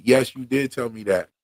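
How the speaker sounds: ordinary talk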